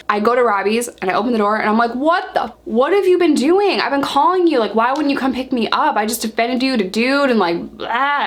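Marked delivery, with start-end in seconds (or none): 1.9-8.3 higher-pitched, exasperated retold rant; mad outburst. Storyteller confronting friends who never picked her up, mad and exasperated. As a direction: demand answers with indignant, rising pitch. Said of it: "and I'm just mad".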